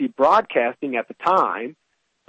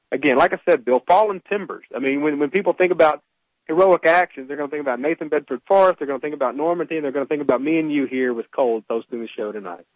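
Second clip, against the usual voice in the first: normal talking voice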